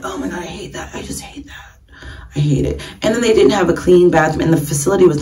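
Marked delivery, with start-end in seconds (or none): 0.0-2.8 hushed hate it, almost whispered; whispered disgust. Retold or imitated.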